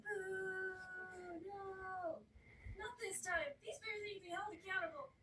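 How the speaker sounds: mock angry crowd. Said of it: "people are like".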